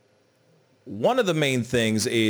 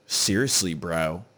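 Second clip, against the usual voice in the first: usual speaking voice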